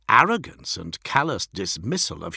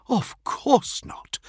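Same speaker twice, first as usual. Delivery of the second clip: higher, drawn-out mild assurance; hasty placating assurance; much slower than usual. Acted. Envoy reassures an offended lord, mildly placating. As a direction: lightly placate your superior, stretching it.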